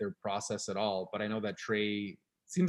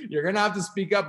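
laughing; 6 semitones higher, 7 dB louder than usual. Acted. Laughing joke reply.

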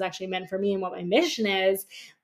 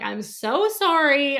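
drawn-out, higher, louder retold apology; emphatic apology; 6 semitones higher, 5 dB louder, much slower than usual. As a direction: stretch it out, apologizing profusely. Retold apology for being late.

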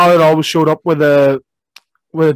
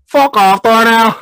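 mock quote; 6 semitones higher, 5 dB louder, much slower than usual. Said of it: "one of my favourites".

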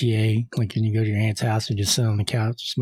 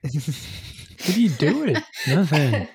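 high, slow, startled when caught alone; startled embarrassed; 5 semitones higher, slower than usual. Pretend. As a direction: sound caught out, then laugh it off. Acting caught being weird alone.

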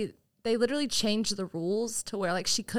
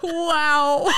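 laughing; 8 semitones higher, 5 dB louder than usual. Real, not acted. Laughing at own mistake.

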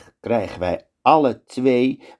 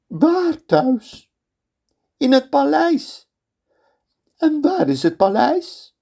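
much higher, sharp questions; interrogating sultan; slower than usual. Acted. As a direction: grill the prisoner.

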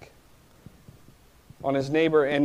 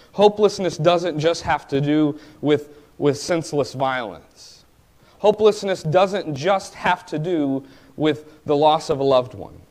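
preaching build; 2 semitones higher, 4 dB louder than usual. Acted. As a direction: preach it, rising with each repeat. Preacher builds repeated phrase.

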